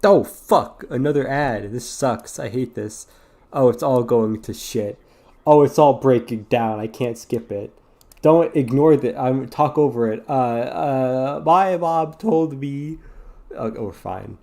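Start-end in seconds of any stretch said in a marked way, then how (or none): 0.0-10.2 loud, exasperated cursing; frustrated outburst. Real, not acted. Gamer cursing an unskippable ad, loud and frustrated. 10.3-13.0 high, slow goofy voice; silly put-on voice. Talking over the ad in a goofy slow voice.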